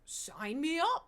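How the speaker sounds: slow, loud, high mock enthusiasm; sarcastic eagerness. Joking to chat about the no-sex show, with slow loud mock eagerness. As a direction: drag it out like you're all in.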